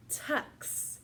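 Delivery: ordinary talk, nothing marked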